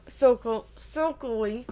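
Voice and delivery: plain reading voice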